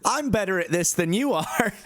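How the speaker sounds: high-pitched mock put-down with a laugh; high, put-on celebrity boast, amused; Russell Brand retort. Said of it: "he just goes".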